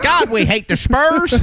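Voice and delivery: put-on high, slow Texan accent. A Texan sports fan complaining, slow, in a Texan accent. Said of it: "you go to Texas".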